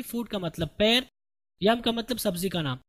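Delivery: normal talking voice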